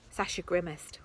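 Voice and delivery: usual speaking voice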